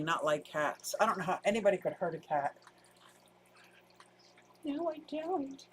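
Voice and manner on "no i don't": soft, slow little squeak; high-pitched squeaky voice. Real, not acted. Talking fondly about the cat, high-pitched squeaky voice. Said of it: "I won't use my high-pitched, squeaky voice".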